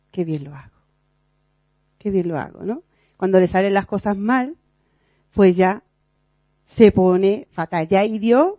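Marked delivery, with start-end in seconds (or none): 0.1-2.6 smug inner voice. Retold or imitated. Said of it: "dice en su interior".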